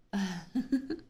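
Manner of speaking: breathy teasing chuckle. Acted. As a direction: chuckle at the prisoner's plight. Vampire mocks naive captive.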